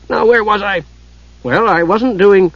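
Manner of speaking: unbothered Kender. A narrator's reading. Kender resumes his muddled argument, unbothered. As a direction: pick up the prattle obliviously.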